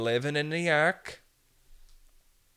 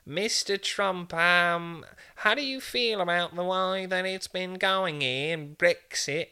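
higher, slow, put-on reporter voice; mock interviewer; 6 semitones higher than usual. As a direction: ask it like a prim British reporter. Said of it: "today we have Donald Trump".

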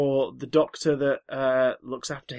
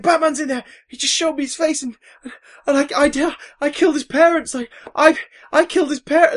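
high, loud, near-tearful wail; a crook in hysterics at his own crime; panicked confession; 11 semitones higher, 6 dB louder than usual. Retold or imitated. Mugger panics, retold cartoon scene.

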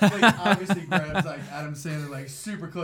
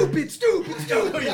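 much higher laughing mock voice; high squeaky quote; 5 dB louder than usual. Quoting Farley skit line.

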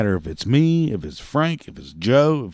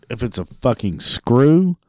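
ordinary delivery

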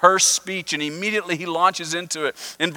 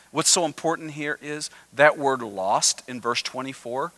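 ordinary delivery

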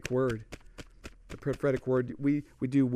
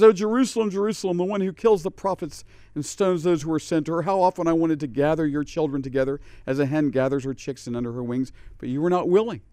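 lament; 2 semitones higher, 6 dB louder than usual. Acted. Reads Jesus' lament with force.

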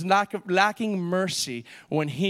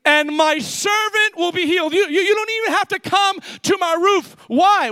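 high, driving pulpit voice; forceful preaching; 10 semitones higher, 5 dB louder than usual. Real, not acted. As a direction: preach it urgently to the congregation.

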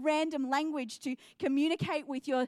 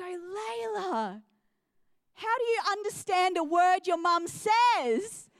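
higher, drawn-out imitation of a baffled friend; mock bafflement; 4 semitones higher, 3 dB louder, slower than usual. Friend asking about mum's speech, mock baffled. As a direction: play up the friend's disbelief.

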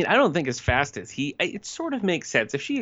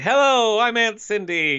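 much higher and louder, a sing-song hello; flouncing entrance; 10 semitones higher, 4 dB louder, slower than usual. Retold or imitated. Cindy flouncing into the scene to meet Rick, hammed-up and high. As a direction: sweep in with a theatrical, showy greeting. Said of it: "flounces into the scene and is like".

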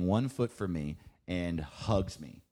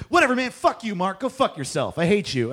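much louder, higher fed-up yelling; yelling back; 11 semitones higher, 10 dB louder than usual. Retold or imitated. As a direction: tell him off at full volume. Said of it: "me yelling back at him".

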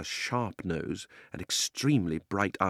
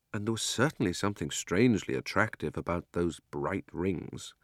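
usual speaking voice

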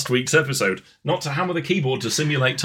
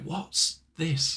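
imagined puzzled colleague; 4 semitones higher, 5 dB quieter than usual. Acted. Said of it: "everyone else is like".

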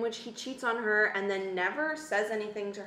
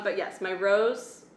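normal talking voice

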